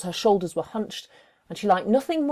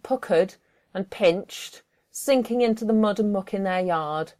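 plain reading voice

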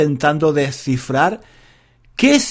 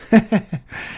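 short amused laugh; 5 semitones higher than usual. Real, not acted. Laughs at his own point.

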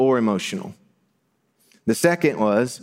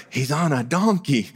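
higher-pitched, mock-amazed crowd member; excited onlooker; 4 semitones higher than usual. Crowd member spotting the king on a donkey, excited. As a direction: act out a thrilled onlooker. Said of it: "they were like".

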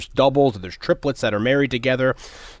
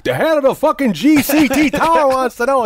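higher, louder indignant retort; mock indignant boast; 12 semitones higher, 5 dB louder than usual. Boss telling off a rude commuter, louder and higher. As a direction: put the guy in his place.

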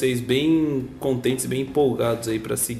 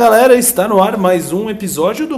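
loud, high, hyped show opener; radio announcer; 7 semitones higher, 7 dB louder than usual. Acted. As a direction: announce the show with big energy. Podcast opening announcer.

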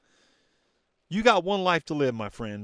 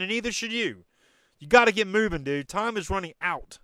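high, loud, fired-up push; loud high pep-talk rant at listeners; passionate raised voice; 7 semitones higher, 5 dB louder than usual. Real, not acted. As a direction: shout them out of their excuses. Fires back at excuse-makers.

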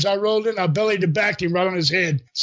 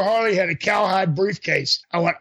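normal talking voice

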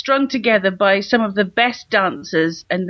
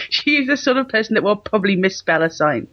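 usual speaking voice

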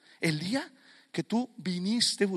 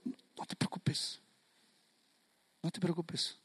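hushed, almost whispered comfort; gentle reassurance; 10 dB quieter than usual. Acted. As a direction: soothe a worried follower very softly. Jesus reassures the doubting speaker.

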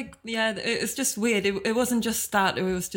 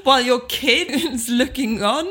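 raised, loud incredulous retort; outraged disbelief; 4 semitones higher, 5 dB louder than usual. Retold or imitated. Woman retelling her reply to a creepy date, outraged. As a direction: pile on the mock horror, loudly.

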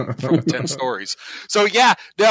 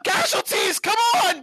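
very high mock shouting; Bouncing Betty scream. Bouncing Betty rousing Deacon to battle, screaming high. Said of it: "Bouncing Betty screams".

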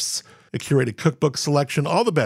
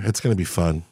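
usual speaking voice

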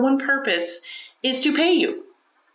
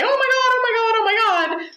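high, louder hammed-up panic; mock freak-out; 14 semitones higher, 3 dB louder than usual. Mocking a panicking captain who runs around, hammed-up freak-out. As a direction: squeal it like a mock panicking crewmate. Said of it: "She doesn't freak out and run around and say".

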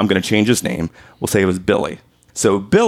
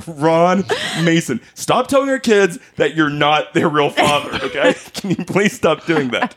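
mock-stern, higher-pitched mom; scolding mom imitation; 7 semitones higher than usual. Mom scolding Dad, retold.

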